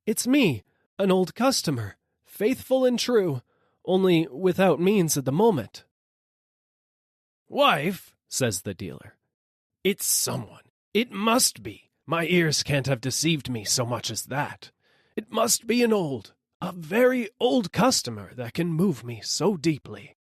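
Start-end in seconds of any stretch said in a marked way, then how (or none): 0.1-5.8 higher, earnest begging voice; pleading rider. A narrator's reading. 7.5-8.1 higher call to his wife; dealer calling. A narrator's reading. 9.8-20.2 higher, insistent conviction; moved dealer. A narrator's reading.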